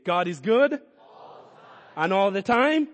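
mean every word; said like you mean it. Shown as a demonstration. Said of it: "And now say it like you mean it".

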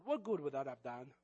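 ordinary talk

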